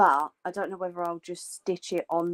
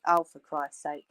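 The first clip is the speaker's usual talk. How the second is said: normal talking voice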